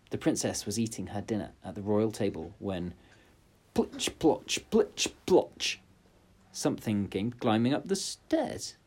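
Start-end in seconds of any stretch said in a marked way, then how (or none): 3.7-5.8 high, wet squelching sound; squelchy steps. A narrator's reading. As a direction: make the wet footsteps.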